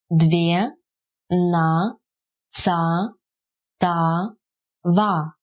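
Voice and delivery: "Две, на, ца, та, ва" as separate drawn-out syllables. Shown as a demonstration. Teacher breaking down a numeral for learners, syllable by syllable. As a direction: pause after each of "Две, на, ца, та, ва".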